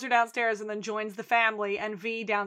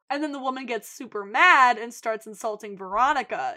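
normal talking voice

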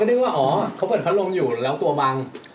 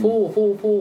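blown-out "fuu, fuu, fuu" of a fan; imitated fan whoosh; 8 semitones higher, 5 dB louder than usual. Storyteller demonstrates the fan noise heard on Skype, imitated whooshing. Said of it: "มันก็เหมือนเสียงพัดลม".

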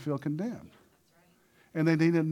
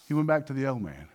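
normal talking voice

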